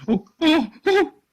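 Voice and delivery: very high, loud yelps; short yelps from a blow; pained cries. Acted. Caruso elbowed in the belly by the boss, yelping.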